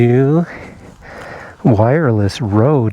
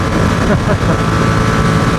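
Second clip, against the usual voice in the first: breathy laugh; 6 semitones higher than usual. Real, not acted. Laughs at noisy helmet audio.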